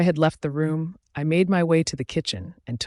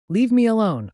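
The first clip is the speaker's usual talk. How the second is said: higher, louder outburst; 8 semitones higher, 6 dB louder than usual. A narrator's reading. Grieving sister orders her mate out, raised and loud. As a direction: push them away with a raised voice. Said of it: "I said out loud".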